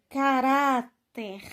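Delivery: caráter slow, with RÁ stretched and strong; penultimate stress demo. Shown as a demonstration. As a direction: hold the RÁ in caráter, then place TER. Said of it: "palavras paroxítonas que são terminadas".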